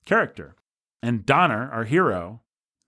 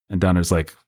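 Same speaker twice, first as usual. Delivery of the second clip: ordinary talk, nothing marked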